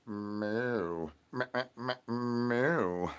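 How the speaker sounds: quiet stuttered meowing; soft cat meows. Acted. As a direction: meow softly like a cat. Cat noises in routine.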